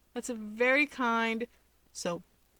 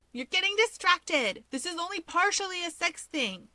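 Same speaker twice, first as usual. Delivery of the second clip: put-on much higher, bubbly shouting girl; excited outburst; 10 semitones higher than usual. A narrator's reading. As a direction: burst in to correct them. Himiko cuts in excitedly.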